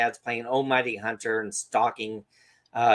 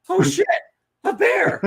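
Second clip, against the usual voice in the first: very high, loud mock alarm; startled; 7 dB louder than usual. Dad finally seeing the bear, startled. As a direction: yelp it as the penny drops, played for laughs.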